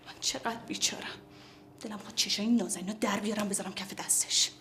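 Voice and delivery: bitter whisper to herself; jealous muttering whisper; whispered aside. Acted. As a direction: hiss it under your breath so nobody hears.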